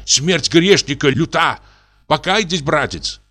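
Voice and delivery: a fervent rebuke, mildly voiced; growing anger. A narrator's reading. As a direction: preach at him, angry and rising. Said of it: "продолжал Матвей громко, тоже начиная сердиться".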